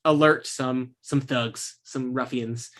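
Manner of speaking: usual speaking voice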